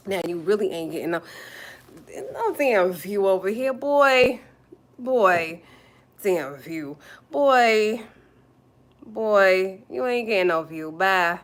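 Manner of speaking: loud, scornful send-off; sassy dismissal. Real, not acted. Streamer telling Sugar Boy he gets no view, dismissive. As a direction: shut the heckler down loudly. Dismissing the commenter with mock outrage.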